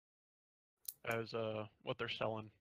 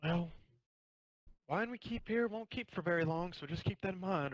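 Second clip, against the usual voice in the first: higher, wry innkeeper woman; knowing warning from a barkeep; 7 semitones higher than usual. Acted. DM voices innkeeper Sasha.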